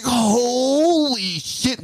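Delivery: high, slowly stretched awe; retold amazement. Watching the crowd erupt, retold amazement. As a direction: draw it out high in astonishment.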